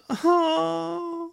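drawn-out high moan of pain; high pained groan. Acted. Pregnant Marleen with belly pain, high acted groan. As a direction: wail in pain. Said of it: "Sie klagte".

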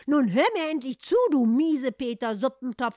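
loud, high indignant demand; offended cat demands attention, loud. Acted. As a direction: insist he listen. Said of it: "Empört".

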